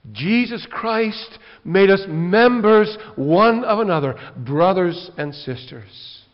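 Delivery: fervent pulpit proclamation; preaching at full force. Acted. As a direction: thunder it to the congregation.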